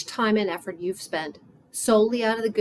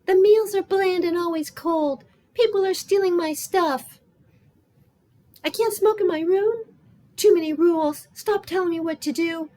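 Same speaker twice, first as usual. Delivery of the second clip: high, louder, whiny string of grievances; complaining; 9 semitones higher, 4 dB louder than usual. Acted. Mona mimicking Becky's nonstop grumbling, complaining. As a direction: gripe in a pitched-up put-on voice. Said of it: "She complains nonstop about everything".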